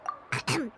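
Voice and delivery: throat clearing. Acted. Composing herself before acting innocent.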